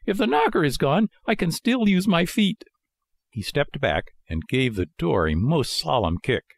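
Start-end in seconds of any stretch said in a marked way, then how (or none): 0.1-2.6 high, indignant puppet voice; high-pitched Pinocchio temper; enraged. A narrator's reading. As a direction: threaten as a cross little marionette. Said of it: "blind with rage".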